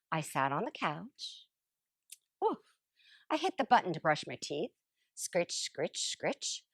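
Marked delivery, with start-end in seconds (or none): 5.1-6.6 softly voiced brushing sound. Imitating toothbrush sound.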